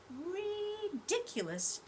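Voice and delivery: very slow, drowsy ridiculous; sleepy drawl. Acted. Tortoise dozing off mid-word, slow sleepy. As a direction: let it trail off into sleep. Falling asleep.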